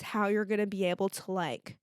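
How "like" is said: usual speaking voice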